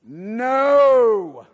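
NO stretched long and loud, high arching pitch; drawn-out emphatic NO. Shown as a demonstration. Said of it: "a long and hard word to pronounce".

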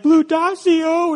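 high, drawn-out imitated child's whimper; still crying. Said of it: "he's still crying".

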